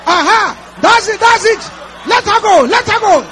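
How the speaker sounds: high, loud shouted deliverance prayer; fiery preaching. Real, not acted. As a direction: command the darkness out at full force. Deliverance prayer shouted at full force.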